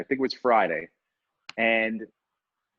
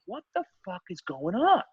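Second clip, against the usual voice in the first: higher, mock-baffled outburst; rising, exasperated question; 5 semitones higher than usual. Hotel guest fed up with the whining, exasperated disbelief.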